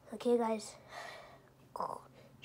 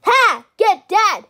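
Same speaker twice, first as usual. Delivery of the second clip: loud mocking yell; taunting shout; 8 semitones higher, 13 dB louder, much slower than usual. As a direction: yell it in his face.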